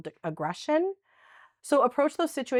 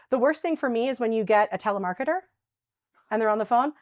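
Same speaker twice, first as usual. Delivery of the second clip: usual speaking voice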